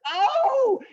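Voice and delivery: high, loud, drawn-out "oh"; hammed-up cry of "oh"; mock outraged "oh". Rabbit reacting to turtle's boast, mock outraged "oh".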